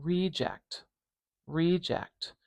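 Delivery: "reject" with a long, powerful first E; stress on the start. Shown as a demonstration. Teacher contrasting the noun with the verb, stress on the first syllable. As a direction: lean on the "re" of "reject". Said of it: "length and power on this E vowel".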